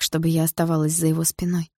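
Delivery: plain reading voice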